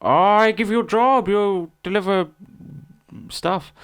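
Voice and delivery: high, loud, slow mock Chinese accent. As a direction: offer the job like the shop lady, slowly. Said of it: "She went".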